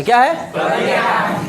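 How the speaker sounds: slow, laughing call for the answer; drawn-out laughing prompt. Shown as a demonstration. Teacher calling for the class's answer, slow and laughing. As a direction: stretch it out with a laugh. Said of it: "prompts class to answer".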